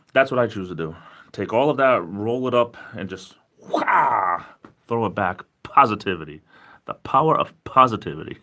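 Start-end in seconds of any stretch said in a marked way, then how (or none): 3.7-4.4 loud drawn-out "whaa" whoosh; effortful hurling sound effect; throwing noise. Acted. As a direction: heave it away with a long "whaa". Acts out hurling negativity away.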